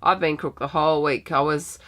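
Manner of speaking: ordinary talk, nothing marked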